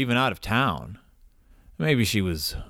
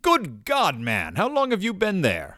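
startled; 8 semitones higher than usual. Acted. Startled by sudden butler.

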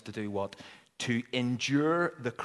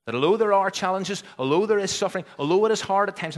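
louder and higher, building; preaching crescendo; 8 semitones higher, 10 dB louder, faster than usual. Real, not acted. Preacher building to a climax for the congregation, loud. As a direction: drive each "although" harder.

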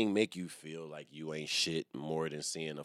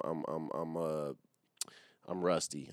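ordinary talk

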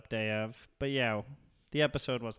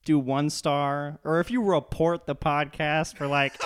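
loud, raised-pitch comic outrage; mock-angry rant; 4 semitones higher, 7 dB louder than usual. Threatening listeners who would give one star, loud mock outrage. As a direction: go off on the haters, half joking.

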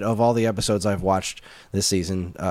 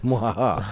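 evil cackle. Retold or imitated. Villain Santa laugh in retelling.

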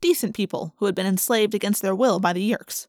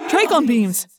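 louder, urgent cry; a sharp cry of alarm; 2 semitones higher, 5 dB louder than usual. A narrator's reading. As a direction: call out a warning. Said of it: "Ax cried".

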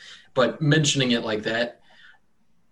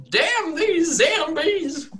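high, slow laughing yell; hammed-up farmer yelling at zombies; 4 dB louder, much slower than usual. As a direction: shout it to the sky in comic rage. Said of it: "he starts yelling out".

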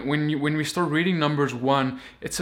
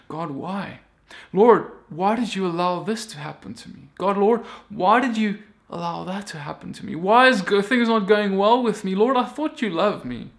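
higher pleading lament; questioning believer; 5 semitones higher, 3 dB louder than usual. Retold or imitated. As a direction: plead with God as a hurting believer. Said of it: "today we ask the same question".

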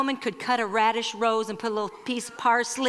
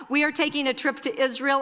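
ordinary talk, nothing marked

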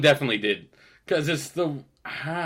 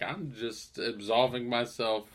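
soft, slow self-justifying murmur; a touch of hushed sanctimony in the meeting; smug quiet; 2 semitones lower, 7 dB quieter than usual. Retold or imitated. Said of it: "He's like".

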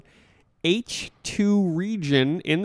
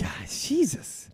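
whisper; 5 semitones higher, 8 dB quieter, slower than usual. Pretend. Mock exasperation.

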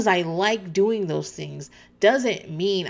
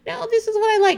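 high-pitched mock protest; mock insistence; 4 dB louder, faster than usual. Host mimicking Anna defending her relationship, mock-insistent. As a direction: imitate someone stubbornly in denial, pitched way up.

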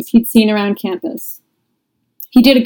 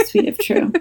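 giggled repeat, much higher; laughing; 12 semitones higher, 4 dB quieter than usual. Real, not acted. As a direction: laugh the words out.

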